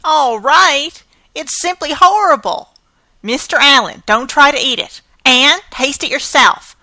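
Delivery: indignant scolding. Acted. Marilla scolds over ruined cake.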